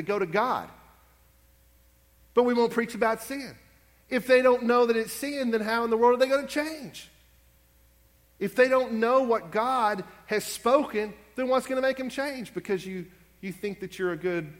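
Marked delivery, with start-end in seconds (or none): 2.3-12.5 fiery preaching; preaching at full force. Acted. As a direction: drive the point home.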